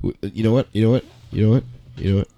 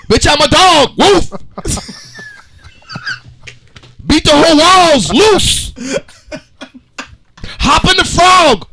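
hyped dramatic reading; 11 semitones higher than usual. Shown as a demonstration. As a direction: ham up the verse with big energy. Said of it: "we are gonna have a dramatic reading".

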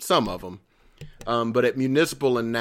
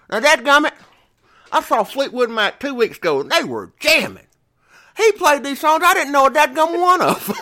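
high, loud folksy complaint; Southern lady; 6 dB louder than usual. Retold or imitated. Concertgoer leaving the [name] show complains, high mock-Southern whine. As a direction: squawk it like an indignant old Tennessee woman. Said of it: "she says".

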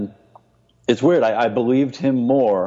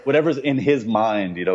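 ordinary talk, nothing marked

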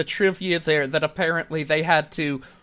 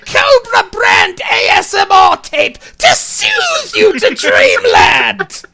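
much higher, louder shrieking villain; screeching villain voice; 6 dB louder than usual. Acted. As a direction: screech it like a cartoon snake tyrant. Villain voice bit continues.

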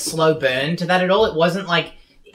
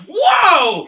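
shouted whoa; 12 semitones higher, 7 dB louder than usual. Retold or imitated. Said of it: "I'd be like".

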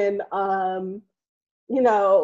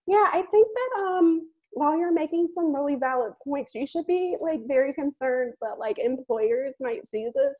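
mom voice; 6 semitones higher than usual. Retold or imitated. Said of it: "she's like".

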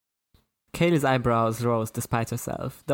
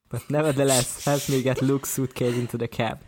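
ordinary delivery, nothing marked

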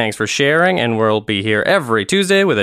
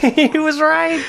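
laughing quote. Retold or imitated. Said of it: "we're like".

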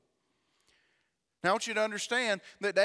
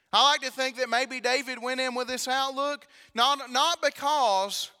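loud, higher preaching climb; preaching at full force; 4 semitones higher, 6 dB louder than usual. Acted. Preacher building to the congregation, loud and high. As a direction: drive it home to the congregation.

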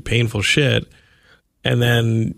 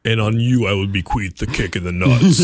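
booming God voice. Pretend. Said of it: "God was like".

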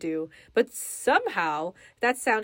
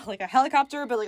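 normal talking voice

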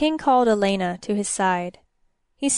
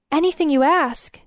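"Anything" higher, then falling; emphatic assent; 6 semitones higher, 4 dB louder than usual. Acted. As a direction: promise firmly, punching "Anything". Elena confirms her promise emphatically.

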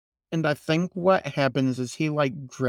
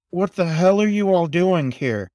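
louder, higher snap of a question; angry demand; 4 semitones higher, 5 dB louder than usual. Acted. Crow yells at the raven, loud and angry. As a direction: demand answers, voice raised.

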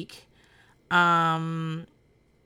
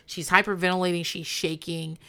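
normal talking voice

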